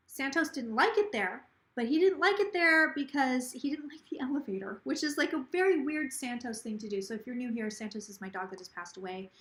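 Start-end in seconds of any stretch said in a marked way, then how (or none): none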